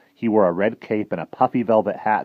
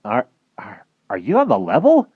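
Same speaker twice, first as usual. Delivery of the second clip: stammering in disbelief; 6 semitones higher, 7 dB louder than usual. Acted. Flabbergasted Fergie stammers.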